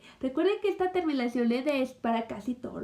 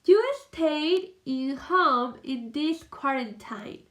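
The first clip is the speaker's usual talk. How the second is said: "You stayed in home in this quarantine" said slowly, word by word; slow model sentence; slower than usual. Shown as a demonstration. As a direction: read it slowly for the class to follow. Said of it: "Veamos la siguiente oración".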